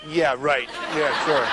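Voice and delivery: dry mock scoff; the men in the audience scoffing, sarcastic and dry. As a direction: roll your eyes in a deadpan sneer. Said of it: "the men all sit there going".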